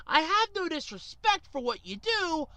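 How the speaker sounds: ordinary delivery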